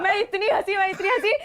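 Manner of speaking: real giggles breaking in; laughing while recalling the wrecked car. Real, not acted. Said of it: "मैं बहुत हंसी".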